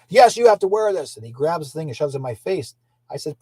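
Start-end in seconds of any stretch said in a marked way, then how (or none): 0.1-1.2 louder, higher bossy insistence; insistent banker. Retold or imitated. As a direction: push it on him like a pushy banker.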